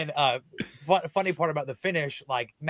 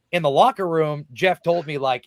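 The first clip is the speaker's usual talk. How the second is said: usual speaking voice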